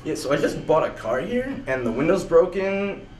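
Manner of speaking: ordinary talk, nothing marked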